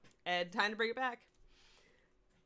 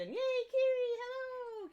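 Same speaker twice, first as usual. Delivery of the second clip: high cheery greeting; 4 dB louder, much slower than usual. Real, not acted.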